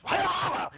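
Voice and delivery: high yelping wah wah wah; loud high mock whine; dog whining. Yorkie yelping while the paw is treated, high whine. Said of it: "and he goes".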